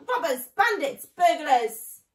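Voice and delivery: high, loud furious shriek. A narrator's reading. Rat shrieking after the fleeing thieves, loud and high. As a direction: scream it after them. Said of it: "shrieked Rat".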